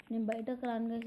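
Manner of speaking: normal talking voice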